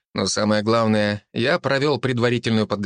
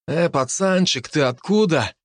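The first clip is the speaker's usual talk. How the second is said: russian street thug; 6 semitones higher than usual. Acted. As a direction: voice a swaggering street punk. Gopnik accosts narrator.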